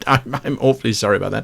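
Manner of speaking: ordinary talk, nothing marked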